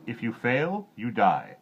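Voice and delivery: loud cold threat; a king threatening death; king's threat. A narrator's reading. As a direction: drop the threat flatly. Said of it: "the king said only".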